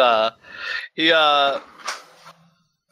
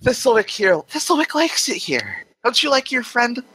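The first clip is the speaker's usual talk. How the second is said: higher, measured voice of Death; Death's voice; 9 semitones higher than usual. Acted. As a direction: speak as a calm, amused Death.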